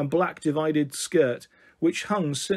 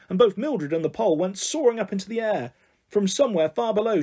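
plain reading voice

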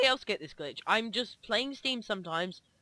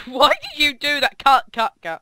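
laughing shout; 4 semitones higher, 12 dB louder than usual. Real, not acted. Friend disconnected mid recording.

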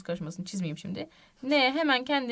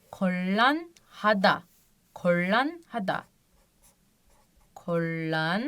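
kollanhada yavaş, çift l ile; ağır ağır kollanhada; slow model; 2 semitones lower, much slower than usual. Shown as a demonstration. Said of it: "Kollanhada olarak okunuyor". (yavaş örnek okuma)

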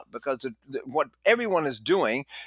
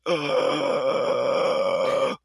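imitated gasp; 5 semitones lower than usual. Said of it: "He said".

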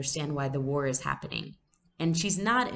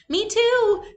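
put-on much higher, louder child's plea; eager little girl; 10 dB louder than usual. A narrator's reading. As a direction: beg to come along like a small child. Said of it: "said Kirsty".

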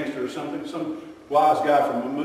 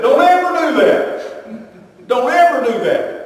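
laughing warning; 10 semitones higher, 8 dB louder, slower than usual. Real, not acted. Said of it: "laughing warning".

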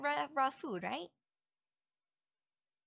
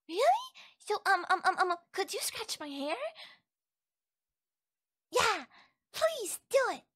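high, loud, tumbling eager plea; eager excitement; 7 semitones higher, 7 dB louder than usual. Acted. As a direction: beg for it, bursting with excitement. Eager request for hair scratching.

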